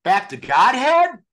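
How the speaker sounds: high, loud, slow eager question; eager blurt. Retold or imitated. Starstruck boy blurting back to the guru, high and loud. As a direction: blurt it out hopefully.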